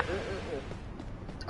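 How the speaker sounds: quiet disapproving mm mm; grandma disapproving hum. Retold or imitated. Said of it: "She's like".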